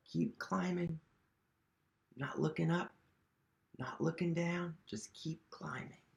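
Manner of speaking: hushed, gentle push onward; soft urging. A narrator's reading. Humpty coaxing themself upward, hushed and steady. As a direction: coax yourself quietly upward. Soft encouragement while climbing.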